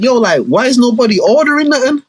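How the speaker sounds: high, puzzled mum voice. Retold or imitated. Mum asking kids why nobody orders, puzzled. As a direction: ask it like a confused mum. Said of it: "Mums was kinda like".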